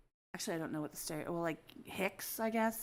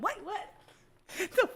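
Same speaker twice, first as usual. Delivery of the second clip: baffled shock; 12 semitones higher, 4 dB louder than usual. Retold or imitated. Reacting to weird phrase.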